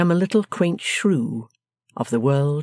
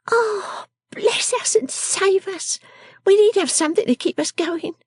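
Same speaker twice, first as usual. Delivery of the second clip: high, quaint little character voice; quaint Miss Wren; slower than usual. Acted. Miss Jenny answering about supper, high quaint voice. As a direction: answer with a high, shrugging weariness.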